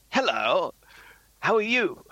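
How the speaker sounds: George Mason. Retold or imitated. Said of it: "put on a, an Eddie Izzard doing George Mason voice".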